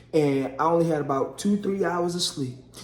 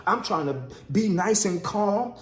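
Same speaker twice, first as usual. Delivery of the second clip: usual speaking voice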